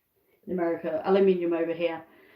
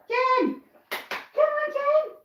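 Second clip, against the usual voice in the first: calling out; slower than usual. Real, not acted. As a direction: sing out to bring her running. Calling hen over.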